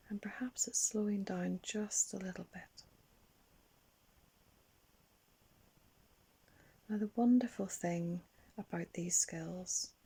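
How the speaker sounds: put-on unhurried, hushed imagery for a children's relaxation; guided meditation. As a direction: gently guide children into calm, letting each phrase settle.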